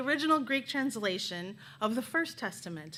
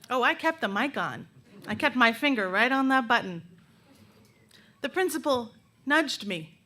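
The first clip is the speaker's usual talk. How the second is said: normal talking voice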